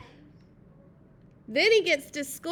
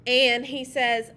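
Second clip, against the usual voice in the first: ordinary delivery, nothing marked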